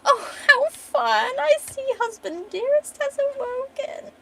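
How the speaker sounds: very high, drawn-out mocking glee; gleeful mad villain. Villain mocks the husband who just woke, manic glee. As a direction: squeal it slowly with villainous delight.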